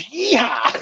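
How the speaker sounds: cowboy whoop of mock pride; whooping yeehaw. Celebrating Australian criminal ancestry.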